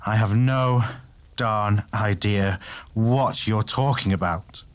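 emphatic, baffled character voice; utterly stumped. A narrator's reading. Tay turns to Alteus, utterly stumped. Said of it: "utterly stumped".

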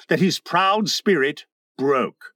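ordinary delivery, nothing marked

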